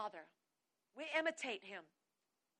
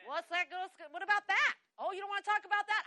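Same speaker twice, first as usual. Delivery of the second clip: higher, louder goading questions; mock challenge, pressing louder; taunting the devil; 6 semitones higher, 5 dB louder than usual. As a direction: needle a cornered opponent.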